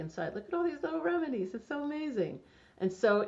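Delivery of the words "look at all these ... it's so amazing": bright, high and quick, full of wonder; delighted. Real, not acted. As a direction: gush over the little bottles. Showing off a kit of remedies.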